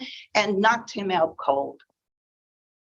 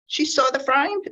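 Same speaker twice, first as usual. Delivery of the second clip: ordinary delivery, nothing marked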